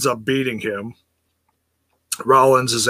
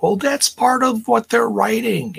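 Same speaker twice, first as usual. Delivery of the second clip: higher, patient explaining voice; imitating wife explaining; 10 semitones higher than usual. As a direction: explain it gently like to a child. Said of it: "my wife was trying to dumb this down".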